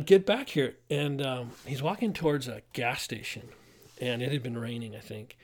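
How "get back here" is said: higher, louder mock shout at a runaway; retold yell. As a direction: bark the order after him. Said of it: "But I'm yelling at him".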